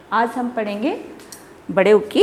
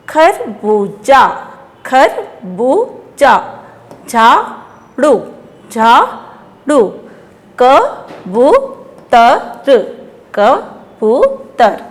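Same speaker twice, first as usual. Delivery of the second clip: "खरबूजा", "झाड़ू", "कबूतर" slowly syllable by syllable; sounding out; 3 dB louder, much slower than usual. Shown as a demonstration. Teacher drilling children word by word, sounded out. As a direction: stretch each long vowel, pausing between syllables. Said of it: "जोर दे के बोलना".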